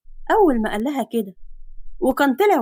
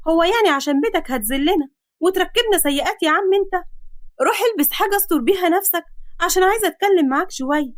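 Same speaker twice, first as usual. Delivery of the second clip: put-on higher girl's voice, indignant; 6 semitones higher than usual. Girl telling man to get dressed, indignant. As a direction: demand he cover up.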